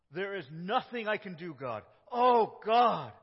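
loud, high desperate cry; Jesus crying out face in the dirt; anguished prayer. Acted. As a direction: pour out helplessness to God. Jesus praying prostrate in anguish.

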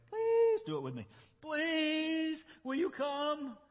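very high pleading whine; begging. Shown as a demonstration. Teacher modeling the plea to Balaam, very high whine. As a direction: plead as if asking mom for a treat. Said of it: "Say it to Balak".

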